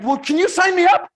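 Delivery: imitating a keen prospect jumping in; eager buyer. Prospect begging the salesman to sign him up, eager. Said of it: "He's like".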